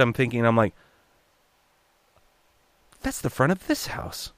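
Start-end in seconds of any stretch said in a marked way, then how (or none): 3.0-4.3 high, soft, incredulous thought; hushed disbelief. Retold or imitated. Voice actor recognizing the house on screen, hushed disbelief. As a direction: squeak it quietly to yourself in disbelief. Silent realization in his head.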